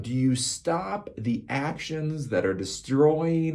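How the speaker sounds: drawn out word by word; slow, emphatic. Acted. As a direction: stretch it out for emphasis. Rhetorical question, drawn out.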